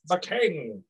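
imitating a feeble herald, pitched high; lightly mocking high-pitched announcement. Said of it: "you don't have a projection voice".

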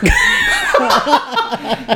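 shrieking laugh, way up high; high peal of laughter. Real, not acted. Laughing at the friend's remark.

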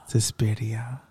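eerie whispered title, rising; spooky whisper. Acted. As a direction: breathe the title like a ghost.

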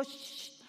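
long hissed "shh"; drawn-out mimicked hushing sound; imitated TikTok shush. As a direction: shush like the little TikTok girl. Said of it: "la chiquita de TikTok que te dice".

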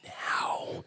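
put-on hushed, whispered scolding of a child; stern stage whisper, imitated; mock scolding parent. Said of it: "like, scolding you".

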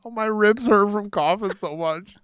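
high, loud, breathless laughing talk. Real, not acted. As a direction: sore from coughing, still laughing. Laughing after coughing.

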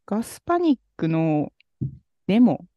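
ordinary talk, nothing marked

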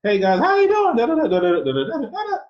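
put-on very high, loud kid squeal; mock voice-cracking squeal. Guest mimics the puberty he never had, high loud squeal. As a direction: shriek it way up high like an adolescent. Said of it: "I didn't do the".

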